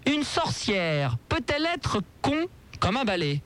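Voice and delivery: slow, spelled-out dictation. Shown as a demonstration. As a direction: dictate it slowly so they can write. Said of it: "Attention, notez-la". (dictée lente)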